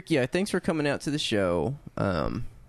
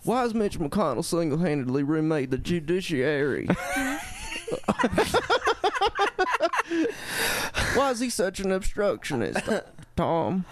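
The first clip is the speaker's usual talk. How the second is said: crying Bill Clinton; 7 semitones higher than usual. Retold or imitated. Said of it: "crying Bill Clinton when I do it".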